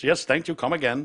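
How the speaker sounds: cartoon shopkeeper's Indian accent, imitated. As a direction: demonstrate a mock Indian accent. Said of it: "that talk like this?".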